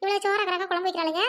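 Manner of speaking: ordinary talk, nothing marked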